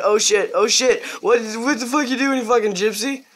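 re-enacted high, agitated reaction; alarmed outburst. Gamer retelling his reaction as they come in, alarmed. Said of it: "I was like".

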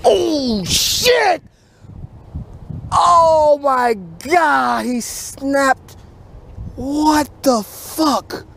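shocked outburst. Real, not acted. Angler losing a big fish at night, shocked yelling. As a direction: cry out as the big fish snaps your line.

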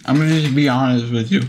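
talking with mouth open; words through an open mouth. Real, not acted. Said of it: "I can't talk with my mouth open".

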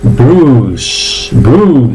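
"Bruce" as long "brus", no I; stretched, falling "Bruce" on pure u; silent I drill. Shown as a demonstration. Teacher drills the last word, "Bruce" with silent I. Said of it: "y la última".

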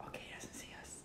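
breathless hiding whisper. Real, not acted. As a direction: whisper so he can't hear. Hiding from killer.

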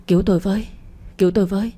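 ordinary delivery, nothing marked

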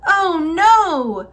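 high, drawn-out "oh no"; dismayed cry. A narrator's reading. Aluki watching her huskies float out to sea, dismayed. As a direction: exclaim in storybook dismay.

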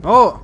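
loud high yelp of shock; startled shout. Real, not acted. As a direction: cry out as you get hit. Gets killed. (grito de sorpresa fuerte)

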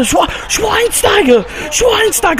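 hyped goal call; excited name shout. Real, not acted. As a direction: belt the player's name as he scores. Calling the scorer's name.

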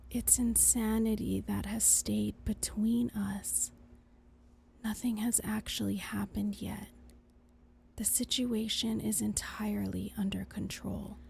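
a hushed conscience pleading; whispering. Acted. Angel whispering.

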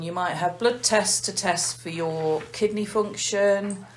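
ordinary delivery

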